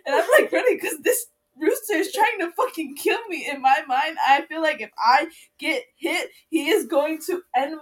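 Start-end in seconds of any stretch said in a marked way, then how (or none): 0.1-3.5 laughing. Real, not acted. Chased by rooster, laughing.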